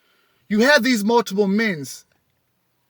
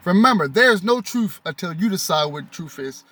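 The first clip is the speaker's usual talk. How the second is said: normal talking voice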